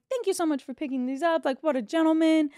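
hyping him up. Retold or imitated. Dealer praises the floor for picking these up, gushing. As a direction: pile on the thanks, rising in volume. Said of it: "I'm hyping my floor up".

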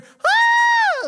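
shrill, loud howl of agony; sudden high shriek, imitating a man in pain; high-pitched pained scream. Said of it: "like that from this dude".

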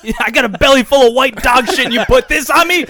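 put-on high, indignant quote of a movie kid. Quoting the Step Brothers kid to friends, mock outrage.

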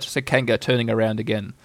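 ordinary delivery

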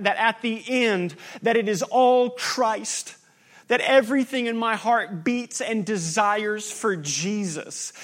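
rising fervent sermon climax; preaching at full force. Real, not acted. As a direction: build the list to a fervent climax. Pastor's rising pastoral appeal.

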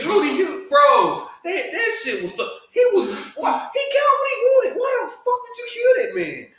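high-pitched, loud, incredulous protest; upset outcry. Real, not acted. Podcast guest protests a killing to co-hosts, worked-up high outcry. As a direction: cry out in disbelief, voice climbing as you go.

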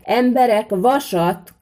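very loud, high call; loud shout. A narrator's reading. Smith calling the villagers to bring iron, loud shout. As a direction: bellow it out to the crowd. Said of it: "kiáltotta Szűcs Lajos, jó hangosan".

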